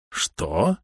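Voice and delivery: impatient, rising question; impatient exclamation. A narrator's reading. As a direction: demand it in dawning horror. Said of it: "нетерпеливо воскликнул Таутон". (нетерпеливый возглас)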